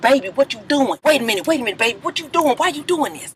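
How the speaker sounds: put-on soft, high pleading boyfriend voice. [name] caught at party begs [name], soft pleading voice. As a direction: beg her softly like a caught man. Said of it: "He just like".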